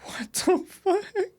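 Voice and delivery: high, slow, drawn-out laughing shock; stunned disbelief. Real, not acted. Streamer reacting to chat's off-road story, high stunned disbelief.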